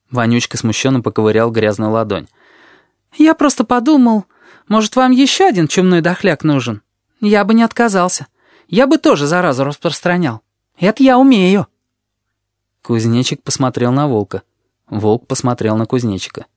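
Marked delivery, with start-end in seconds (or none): 3.2-11.7 put-on high, cheeky little boy voice; sly high kid, teasing; odd kid voice. A narrator's reading. Вонючка asks to join.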